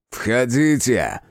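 slow, loud irritated call; irritated devil. A narrator's reading. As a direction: bark it out, annoyed devil. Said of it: "раздражённый голос дьявола".

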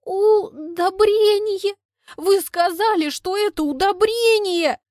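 high, loud, slow Удобрение dragged out; hammed-up tragic outcry; hysterical wail. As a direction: wail in melodramatic betrayal. Hysterical girl accuses Kolya.

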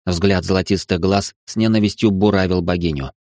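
plain reading voice

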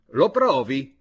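loud exclaimed challenge; exclamation. A narrator's reading. Szechenyi challenging Papa Kunz to prove it, exclaiming. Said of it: "esclamò Szechenyi".

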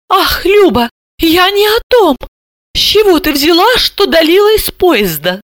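high-pitched, exasperated fussing; cross. A narrator's reading. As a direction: scold your sister in a very high voice. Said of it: "Альбина Яновна рассердилась".

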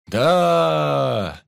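long, loud, high shout; crew's cheer. A narrator's reading. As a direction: roar back in agreement. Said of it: "поддержали меня матросы".

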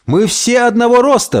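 high, amazed exclamation; astonished cry. Acted. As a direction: cry out a sudden discovery. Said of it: "вскричал Мартинес".

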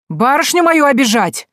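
loud, high outraged yell; angry shout. A narrator's reading. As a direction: defend the young lady. Selivan defending his lady. (возмущённый крик, громко)

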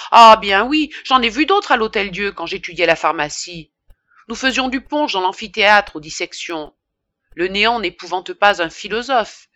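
loud, pompous bragging; boastful pharmacist. Acted. Pharmacist bragging to the women about dissections, loud and pompous. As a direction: puff yourself up and boast.

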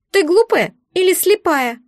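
high, squeaky, indignant creature. A narrator's reading. Egg is offended by the heroine's question, indignant. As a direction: snap it in a high little voice. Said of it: "возмутился подарок".

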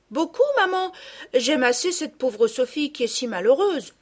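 put-on sweet young daughter answering her mother; a hint of a polite child; little girl Camille.

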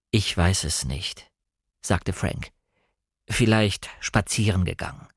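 lightly voiced doctor, low and slow; a hint of measured clinical reserve; doctor to relatives. A narrator's reading. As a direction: answer like a doctor putting off anxious relatives. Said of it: "Er sagte das wie ein Arzt im Pflegeheim".

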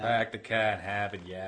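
mock Boston accent with broad flattened A's. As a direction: echo it in a hammed-up Boston accent. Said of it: "person who had a Boston accent".

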